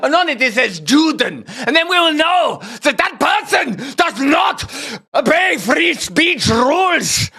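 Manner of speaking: a sinister official growing to a shout; mock official. Host satirising police as Nazi officials, mock gleeful shout. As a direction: play a gleeful tyrant announcing rules.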